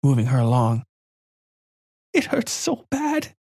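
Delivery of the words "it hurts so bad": put-on very high, slow, pained child voice; a hurting girl whimpering it out; little girl in pain. A narrator's reading.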